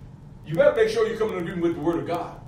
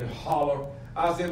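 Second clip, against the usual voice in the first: normal talking voice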